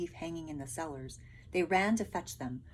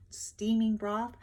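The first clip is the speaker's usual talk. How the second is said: plain reading voice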